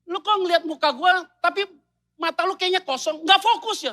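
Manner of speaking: high, loud nagging question; mock irritated wife; annoyed wife. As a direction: needle him for his blank stare. Wife complaining husband not focused.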